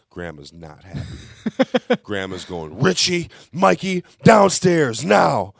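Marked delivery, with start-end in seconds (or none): 2.8-5.5 loud, high, drawn-out summons; imitated furious grandma calling out; angry grandma yell. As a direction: holler for the kids from downstairs. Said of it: "yells from downstairs".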